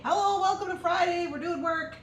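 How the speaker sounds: high, loud, bubbly greeting; mock-cheery welcome. Streamer greeting viewers to a working Friday, mock-cheery. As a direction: belt out a playful high welcome.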